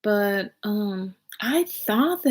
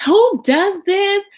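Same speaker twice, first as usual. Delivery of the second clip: outraged question; 11 semitones higher, 5 dB louder, slower than usual. Retold or imitated.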